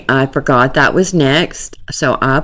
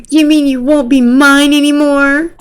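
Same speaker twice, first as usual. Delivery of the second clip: 1 mock girly voice; 10 semitones higher, 3 dB louder than usual. Voicing girl's chat message.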